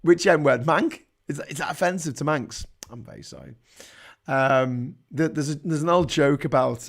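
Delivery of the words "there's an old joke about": ordinary delivery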